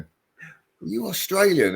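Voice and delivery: high, drawn-out mock query; incredulous question. Strangers in Devon asking his kids, mock incredulous. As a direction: stretch it out, high and loud, imitating a curious stranger.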